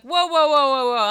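high, loud halt to someone; loud mock protest. Imagined reader stopping Montag burning books, loud mock protest. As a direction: burst in with exaggerated objection. Imagined objection to book burner.

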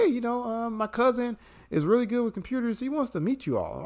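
high, eager voice of a nobody calling IBM; mock phone pitch. A nobody calling IBM to pitch his cousin, eager and high. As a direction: act like a hopeful caller pitching your cousin. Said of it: "I can't call up fucking I B M and be like".

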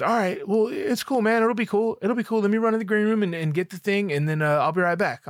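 high-pitched, upbeat brushing-off of worry; imitating reassuring friend. DJ to nervous performer before running to green room, upbeat reassurance. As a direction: voice a buddy talking someone down.

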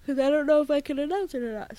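talking through a yawn; words stretched by a yawn. Real, not acted. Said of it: "Sorry, I keep yawning".

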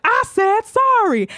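slow, high, drawn-out mock threat; imitated menacing bully. Rosa advances on the father, exaggerated defiance. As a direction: stretch the apology into a hammed-up challenge.

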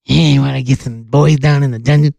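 very low wrestling-trainer impression; Stu Hart. Podcaster luring boys down to the dungeon, as a low-voiced impression. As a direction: drop deep and drag out the last threat. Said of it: "That's my Stu Hart impression".

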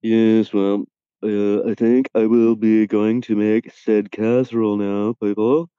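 stuffy, self-important voice; pompous gentleman. Pretend. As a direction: play a pompous toff. Posh mock persona.

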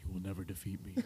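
deep, hushed mock villain; very low talking. Imitating a villain in close-up on the mouth, very low voice. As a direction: murmur the threat way down low. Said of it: "they're talking dumb low".

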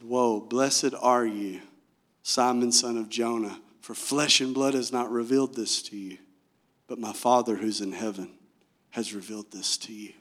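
low, soft, awed acted reply; understated astonishment, voice dropped; quietly shocked. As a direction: play hushed amazement, measured and low. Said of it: "He's shocked that Peter got it".

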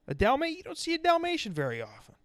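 chirpy, high small-talk greeting; a hint of a cheery neighbor saying hi; friendly hello. Host imagining greeting a couple on the sidewalk, bright and chirpy. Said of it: "said hello ... and said".

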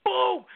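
high, loud verbal crash; imitated impact. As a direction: punch it out like a body slamming down. Imitates the body splash landing.

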